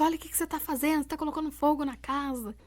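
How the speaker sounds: high, fast, worried telling-off; alarmed sister scolding. Retold or imitated. Sister bursting in on her, mock alarmed scolding. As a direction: rattle it off like a panicked big sister.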